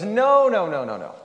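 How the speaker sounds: high, loud protesting no; mock-indignant, high-pitched denial; emphatic denial. Frog denies being Kermit the Frog.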